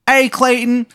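high, loudish hammed-up Maryland greeting; badly faked Maryland accent; terrible Maryland accent. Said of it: "in his terrible Maryland accent".

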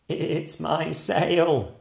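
a bleating boat-owner goat. Acted. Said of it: "said the goat".

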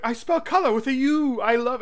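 high-pitched put-on quote; Frasier. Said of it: "I identified with Frasier when he started saying".

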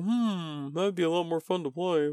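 soft, thinking-to-himself aside; hushed imitation of his own private pondering; wistful musing. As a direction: murmur a tempted thought, starting on a long "hmm". Voices his wistful thought during game.

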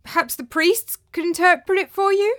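high, tentative suggestion; nervous, placating servant; miserable. A narrator's reading. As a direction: offer it timidly with a rising question. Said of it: "said Andreas miserably".

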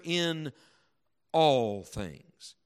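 pause, then a weighty "all"; "all" set apart and drawn out; stress on ALL. Shown as a demonstration. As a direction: stop before "all", then lean on it. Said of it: "I'll emphasize it as I read it".

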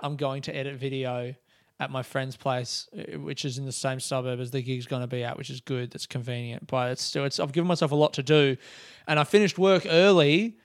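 normal talking voice

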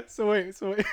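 high loud laughter; laughing hard. Real, not acted. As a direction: crack up mid-sentence.

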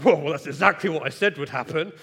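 loud, fast, smug told-you-so. Retold or imitated. Dad scolding hurt son, loud smug I-told-you-so. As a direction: rub it in hard and quick. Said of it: "I just went in and was like".

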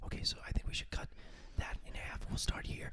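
hushed, unvoiced onstage whisper; imitated whisper. Band member leaning into the bandleader to plan a cut, whispering. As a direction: whisper it quickly into his ear. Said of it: "leaning into Dan and be like".

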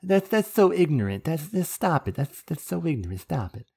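high cartoon kid scolding; South Park voice. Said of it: "go back to South Park here".

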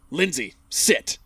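imitating a slow, curt dad order; curt command. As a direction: order her to sit like a dog, slowly. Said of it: "and he just goes".

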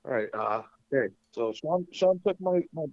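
ordinary delivery

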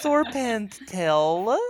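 very slow, drawn-out silly cat talk. Pretend. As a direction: play an internet cat, stretching every vowel. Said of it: "That's my cat voice".

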